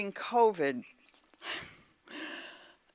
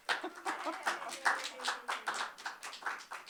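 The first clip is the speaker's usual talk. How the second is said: breathy embarrassed little laugh; a short soft chuckle, trailing off; 5 semitones higher, 9 dB quieter than usual. Real, not acted. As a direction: laugh quietly at yourself. Self-deprecating after doubting her limericks.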